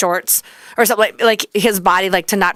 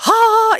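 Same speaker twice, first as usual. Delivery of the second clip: ecstatic sigh; 4 dB louder than usual. Retold or imitated. Ecstatic gasp at retreat high.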